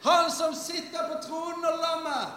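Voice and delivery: high, slow, ringing proclamation; drawn-out heavenly shout, high and slow; proclaiming shout. Acted. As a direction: cry it out like the multitude. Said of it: "De ropte med høy røst og sa".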